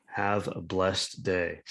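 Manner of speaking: slow, measured sign-off; pointedly polite. Retold or imitated. Telling an angry online opponent goodbye, pointedly polite. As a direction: draw each word out, needlingly courteous. Retelling his needling sign-off.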